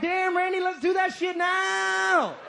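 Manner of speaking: put-on high, loud, eager girlfriend; won-over girlfriend hollering; excited yell. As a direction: yell it high, stretching the end.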